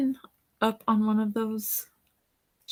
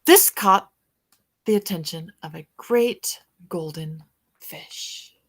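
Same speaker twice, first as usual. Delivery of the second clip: put-on bright, lively read-aloud for children; storybook reading; 5 dB louder than usual. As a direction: read it like a bedtime picture book to kids. Reads picture book aloud.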